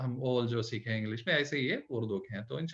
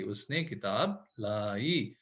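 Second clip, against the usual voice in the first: उसने किताब लाई read very slowly and softly; stretched teaching sentence; slow model sentence; 6 semitones lower, 5 dB quieter, much slower than usual. Shown as a demonstration. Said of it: "लाम अलिफ ऐसे".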